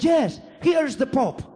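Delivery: put-on high, loud toddler shout; a small boy yelling that he is right; child insisting loudly. Son insisting to papi that the Pope is there, high loud child shout.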